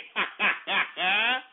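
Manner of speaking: short run of ha-ha laughter. Real, not acted. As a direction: laugh it out after a boast. Laughs after boasting he feels hardcore.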